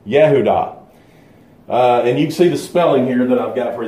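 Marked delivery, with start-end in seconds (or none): 0.1-0.8 "Yehudah" set apart as a careful demonstration; Hebrew pronunciation. Shown as a demonstration. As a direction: say Yehudah as yeh-hoo-DAH. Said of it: "is how it's pronounced in the, in the Hebrew".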